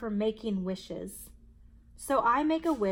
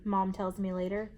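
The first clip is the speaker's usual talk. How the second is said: usual speaking voice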